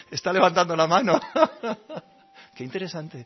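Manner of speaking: amused at the danger, can't stop laughing; laughing narration. Acted. Meditator describing a shouting man, laughing through it.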